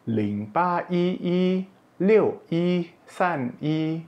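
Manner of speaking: each yi held high and level; first-tone yi. Shown as a demonstration. Teacher reciting a phone number, yi in high level tone. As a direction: read out digits with yi on a sustained high pitch. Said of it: "ini juga nada satu".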